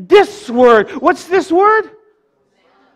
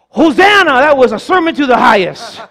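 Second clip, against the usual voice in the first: mock gushing praise; 2 semitones higher, 5 dB louder than usual. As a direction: hail the preacher with over-the-top enthusiasm. Acting gushing churchgoer praising the pastor.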